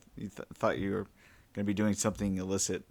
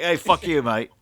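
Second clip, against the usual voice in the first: loud, higher Kiwi retort; Kiwi accent; 7 semitones higher, 10 dB louder than usual. Acted. Pilot answering being called a Kiwi, indignant Kiwi accent. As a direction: snap back in a New Zealand accent. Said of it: "as a Kiwi".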